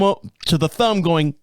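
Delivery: ordinary delivery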